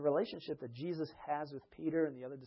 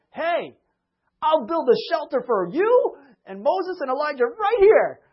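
much higher, louder, slow and eager; eager Peter; 11 semitones higher, 14 dB louder, slower than usual. Retold or imitated. Peter offering shelters on the mountain, excited and loud. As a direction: call it out all excited.

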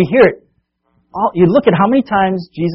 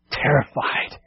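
whispered softly; hushed; 3 semitones lower, 10 dB quieter, slower than usual. A narrator's reading. Preacher hushing the congregation, whispered awe. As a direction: breathe the word in awe. Hushed word after calm.

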